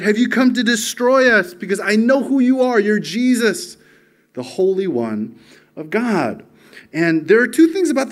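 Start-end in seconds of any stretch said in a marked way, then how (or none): 0.0-6.4 raised-pitch imitation of a demon's outcry; preacher voicing a demon's cry, starting loud. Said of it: "this demon cries out".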